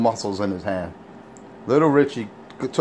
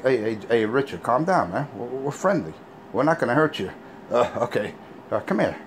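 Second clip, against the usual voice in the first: usual speaking voice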